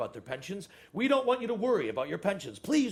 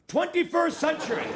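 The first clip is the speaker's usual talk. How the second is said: much higher, louder proclamation; emphatic self-correction; 13 semitones higher, 5 dB louder than usual. Acted. Boss correcting his slip to staff, loud and emphatic.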